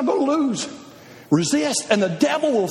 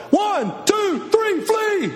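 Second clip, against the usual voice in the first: mock race call; 8 semitones higher, much slower than usual.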